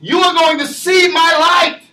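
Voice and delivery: fiery pulpit cadence, shouting the key words; preaching at full force. Acted. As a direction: drive it home to the congregation.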